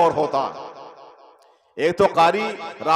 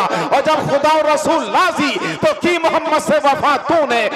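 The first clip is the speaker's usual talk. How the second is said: raised, ringing sermon voice; a fired-up preacher's refrain, higher pitched; preaching at full force; 8 semitones higher, 4 dB louder than usual. Acted. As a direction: preach it out to the crowd.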